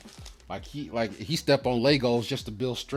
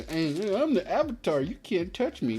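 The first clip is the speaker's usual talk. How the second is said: put-on much higher, cocky kid showing off; mocking Aang; 10 semitones higher than usual. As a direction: whine it up high like a kid bragging to his friends. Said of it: "like I was saying".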